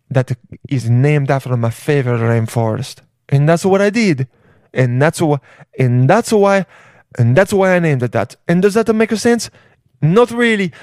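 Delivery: lilting mock Italian accent; comic Italian-accented English; Jeff Bezos with Italian accent. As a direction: tell it as a preening Italian showman. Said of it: "he had an Italian accent".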